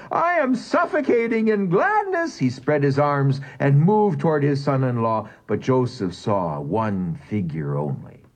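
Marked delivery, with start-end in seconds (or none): 0.1-2.4 much higher, overcome, tearful voice; father bursting into tears of joy. A narrator's reading. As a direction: cry it out in glad tears. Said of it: "he cried".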